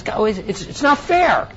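high-pitched, stammering imitation of a frustrated protest; mock frustration. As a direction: act out someone flustered and crying foul. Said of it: "he gets real frustrated".

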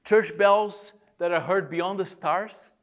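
drawn-out, deliberate recitation; slow poem reading. Shown as a demonstration. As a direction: stretch the line and pause after it. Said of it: "I'm gonna read them slowly".

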